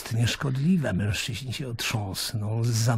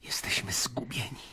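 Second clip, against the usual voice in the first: whisper; 8 dB quieter than usual. Acted. Caiaphas gripping his arm in dread.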